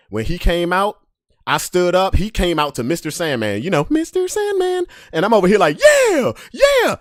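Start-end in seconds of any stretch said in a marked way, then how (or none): 5.8-7.0 high, loud whoop; high loud yeahs; cheering. Retold or imitated. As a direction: hype up your boy alone. Cheering alone for his boy.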